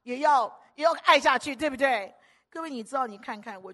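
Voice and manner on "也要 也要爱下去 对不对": louder preaching cry; preaching push. Acted. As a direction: press the point hard. (大声讲道)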